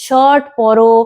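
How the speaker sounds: put-on light, simple instructions to a toddler; a hint of parent-to-child voice; talking to a child. Shown as a demonstration. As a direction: gently coax a little kid into dressing. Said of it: "মানে".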